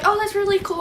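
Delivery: high, sing-song imitation of a girl; mock girly reply. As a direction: parrot her fake-friendly tone. Girl's reply, mocked by teller.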